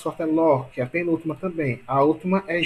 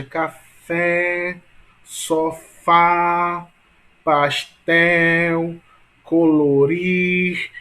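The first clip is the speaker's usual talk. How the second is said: café, sofá, pastel, colorir slow, each final syllable held long; last syllable stretched; 5 dB louder, much slower than usual. Shown as a demonstration. Teacher letting students hear the longest syllable, final syllables stretched. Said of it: "qual é a sílaba mais longa".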